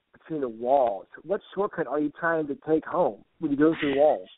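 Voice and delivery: usual speaking voice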